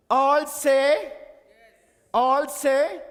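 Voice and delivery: loud, high call with rising end. Real, not acted. Speaker calling the audience to attention, loud call. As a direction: call it out to the whole room.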